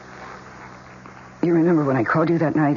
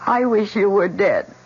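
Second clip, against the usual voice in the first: slow vicious wish; 5 semitones higher, 3 dB louder than usual. Acted. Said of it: "And then I said".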